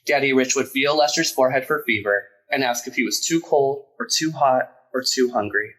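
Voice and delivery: usual speaking voice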